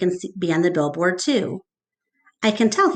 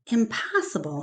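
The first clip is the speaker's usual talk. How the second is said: stunned murmur; 2 semitones higher, 6 dB quieter than usual. Acted. Mac stunned after call.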